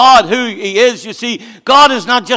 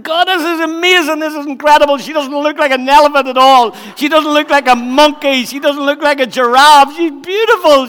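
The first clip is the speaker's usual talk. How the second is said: high, loud, thrilled exclamations; ecstatic Adam; 6 semitones higher, 4 dB louder than usual. Acted. Adam marvelling at his new wife, excited and high. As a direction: gush like a man seeing her first.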